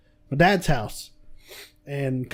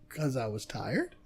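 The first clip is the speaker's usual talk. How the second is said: meek reply; 4 semitones lower, 12 dB quieter than usual. Retold or imitated. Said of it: "I'm like".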